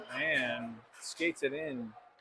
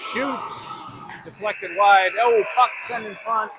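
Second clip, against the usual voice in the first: loud, high, rushed call of the shot; excited play-by-play; 6 semitones higher, 8 dB louder, slower than usual. Real, not acted. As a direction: call the shot with a rising thrill.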